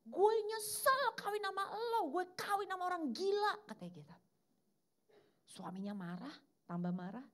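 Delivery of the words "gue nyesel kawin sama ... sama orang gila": much higher, louder mock scream; the wife yelling her regret in public; hammed-up shrieking wife in a quarrel; wife's angry outburst. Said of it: "Istrinya bilang gini".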